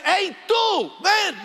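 mock summons, pitched well up; calling out. Preacher imitating an impersonal call to a stranger, calling out. Rude call Jesus would not use.

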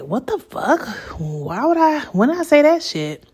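mildly outraged questioning; upset. Retold or imitated. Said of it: "So I was really upset".

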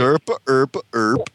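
nonsense Swedish babble; mock Swedish accent. Shown as a demonstration. Answering what Swedish sounds like, mock Swedish accent. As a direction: bounce through fake Swedish syllables. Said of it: "Swedish accent sound like".

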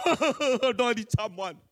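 slow, wavering mock sob; mock wailing. Tempted learner squatting down in despair, mock wail. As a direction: wail comically, stretching it out.

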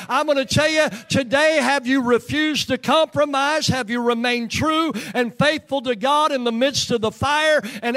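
full-force revival preaching; rousing, insistent preacher's cadence. Real, not acted.

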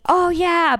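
high-pitched "oh yeah" of sudden recognition; light up as if it just clicked; retold delighted recognition. Comedian retelling his recognition of her voice, high delighted recognition.

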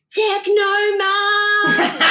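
high, drawn-out holler; imitated crowd yell. Clubber yelling out to the DJ on stage, imitated holler. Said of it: "one kid just yelled out".

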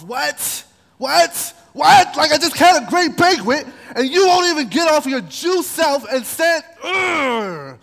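high shouting rage, building over the repeated what; a rising shout of what, what, what, then a disgusted ugh; furious Haman. Acted. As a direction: Haman blowing up, yelling in outrage. Haman enraged at Mordecai.

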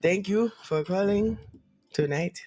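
measured Wakanda accent, dropping low on the last word; Wakandan accent. Shown as a demonstration. Showing a friend Wakanda, Wakandan accent. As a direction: speak deliberately, like a Wakandan. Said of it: "I could do Wakanda".

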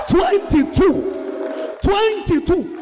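shouted high and slow, stretching twenty-two; incredulous preacher's shout; astonished shout. Real, not acted. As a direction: cry out the number in disbelief. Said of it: "Me papa chiri ba wari twenty-two".

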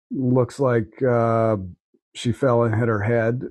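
ordinary talk, nothing marked

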